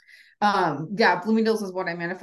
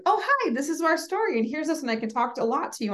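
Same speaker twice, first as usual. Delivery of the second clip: chatty seller to customer; 9 semitones higher than usual. Retold or imitated. Mimicking chatty pitch to customer.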